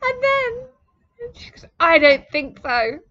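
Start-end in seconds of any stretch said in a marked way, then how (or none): 1.8-3.0 loud, slow, flat refusal; mock shout. Woman refuses her request, mock shouting. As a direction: bark the refusal back. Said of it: "she's shouting at me in her messages".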